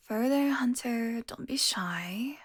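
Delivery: slow, drawn-out coaxing, softening at the end. Acted. Vampire coaxing prey before biting.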